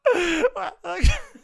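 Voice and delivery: loud, high, helpless laughing speech; manic laughter. Real, not acted. Fan crowing over a goal, manically laughing. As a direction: crack up while getting the words out. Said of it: "laughing manically".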